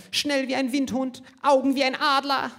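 a blind man who can't read his notes; squinting Himmler. Retold or imitated. Short-sighted Himmler failing to read his speech at the rally, high and fumbling. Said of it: "Dann stand er da".

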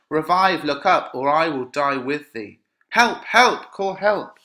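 plead, then call out for help; desperate cry. Acted. Lady Capulet over her dead child, desperate calling.

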